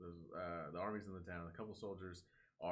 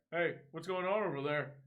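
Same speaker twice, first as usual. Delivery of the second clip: dispatcher; 9 semitones higher, 8 dB louder than usual. Retold or imitated. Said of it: "the dispatchers were like".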